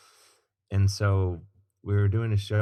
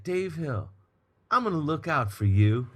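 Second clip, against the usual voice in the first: [name]; 6 semitones higher than usual. Retold or imitated. Star promising to watch out for the opener, imitated high. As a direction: put on a star's high voice, granting a favour. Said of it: "And he's like".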